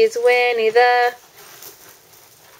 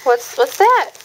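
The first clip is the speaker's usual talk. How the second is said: put-on reading to a toddler. Mother reading animal book to toddler.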